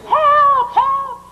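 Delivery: very high, loud squawked help call; imitated peacock cry. Husband mimics the peacocks to his wife, high loud squawk. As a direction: screech it like a mating peacock. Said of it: "he went".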